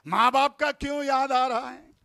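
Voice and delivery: ordinary talk, nothing marked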